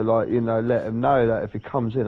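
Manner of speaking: ordinary delivery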